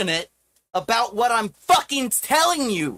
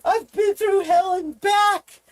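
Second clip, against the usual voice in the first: desperate shout; 5 semitones higher than usual. Real, not acted. Streamer telling uncaring viewers his suffering, desperate shout. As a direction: yell it out at the peak of despair.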